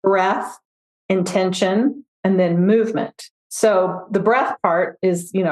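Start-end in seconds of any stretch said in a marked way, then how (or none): 0.0-3.3 three steps spaced out with pauses; slower, on request. Shown as a demonstration. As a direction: say each item slowly and separately, rising on the first ones. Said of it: "Say that again more slowly".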